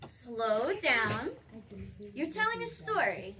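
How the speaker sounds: ordinary delivery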